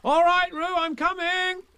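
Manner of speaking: high, loud call. Acted. Christopher Robin calling to Roo in the stream, loud. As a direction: call across the water. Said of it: "called Christopher Robin".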